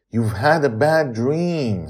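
plain reading voice

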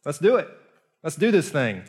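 high, loud, eager encouragement; Jesus calling Peter out onto the water, eager and loud; cheerful Jesus. Retold or imitated. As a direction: pump him up like a buddy. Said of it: "Jesus is like".

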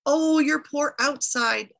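high, slow wail of pity; crying in sympathy. A narrator's reading. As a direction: commiserate theatrically, stretching the words. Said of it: "Asushunamir cried with her".

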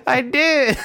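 giddy laughing admission. Real, not acted. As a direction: admit it while cracking up. Laughing while admitting he choked.

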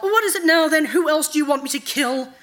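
high, loud, exasperated demand; snarling. A narrator's reading. Araneth challenges the night god's next order, snarling. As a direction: fling the questions at your master. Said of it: "Araneth snarled".

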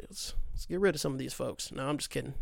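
ordinary talk, nothing marked

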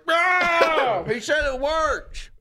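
high, wailing shout, slowing down; desperate protest. Acted. Believer refusing to drop the needle, wailing. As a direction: scream the refusal, then wail it out.

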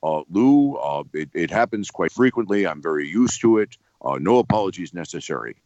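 low, measured rock-star impersonation. Retold or imitated. Rock star reassuring an apologetic radio host, low and formal. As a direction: put on a deep, formal celebrity voice. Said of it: "He said".

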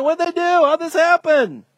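high-pitched badgering; imitated incredulous questioners; mock insistent questioning. Imitating people who keep asking.

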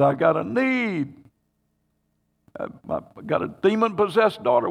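mock plea, stretched out; acted pleading. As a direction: plead like the woman begging for help. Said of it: "she's pleading".

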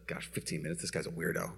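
low, very quiet grumble; muttered inner thought. Retold or imitated. Wife silently judging husband after the fight, low mutter.

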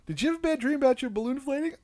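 put-on high, fast coaxing voice to a child; a light sing-song parent voice; gentle parent voice. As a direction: sweet-talk the kid quickly. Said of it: "I'm like".

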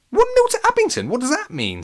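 very high, startled question; baffled. Acted. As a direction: repeat it in high disbelief. Alex baffled.

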